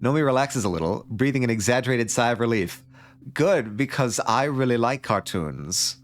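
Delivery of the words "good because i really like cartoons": put-on slow, drawn-out first try at a Tommy Wiseau accent; rough Tommy Wiseau accent, still finding it. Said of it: "I do need to dial in the Tommy Wiseau accent".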